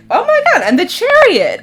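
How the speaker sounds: delighted shriek. Real, not acted. Reader squealing with delight at the Chariot card. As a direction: squeal at the card you hoped for.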